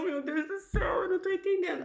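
high-pitched, mildly flustered inner cry; mock nervous panic. Mother not understanding the doctor's German, mock nervous panic. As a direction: act out a lost, nervous thought. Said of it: "eu já tava nervosa".

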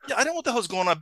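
usual speaking voice

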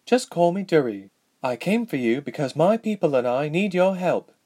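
lightly acted girlish princess voice; young princess, earnest. A narrator's reading. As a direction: ask for help without ceremony.

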